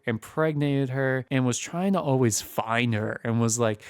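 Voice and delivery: ordinary talk, nothing marked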